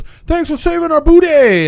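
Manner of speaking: high, loud, drawn-out holler; mock calling out. Man in departing helicopter to Jonas, mock holler. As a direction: call it out over helicopter noise, hammed up. Retold farewell called from helicopter.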